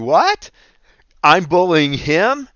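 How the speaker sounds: high, loud, incredulous outburst; shocked protest, stretched out slowly; can't believe he's the one being blamed; indignant disbelief. Retold or imitated. Inner outrage at being called bully.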